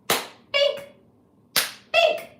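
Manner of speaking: high excited cry of the word; delighted squeal. Real, not acted. Streamer thrilled about her favorite color, high excited cry. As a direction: squeal it with glee.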